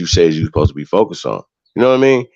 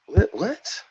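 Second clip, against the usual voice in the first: incredulous; 12 semitones higher than usual. Real, not acted. Reacting to being challenged.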